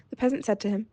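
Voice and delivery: ordinary delivery, nothing marked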